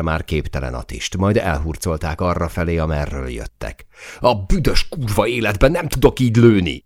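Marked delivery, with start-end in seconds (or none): 4.2-6.8 higher, louder voice swearing in frustration; frustrated angry shouting; furious yell. Acted. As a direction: rage at being unable to shoot. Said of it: "üvöltötte Zó".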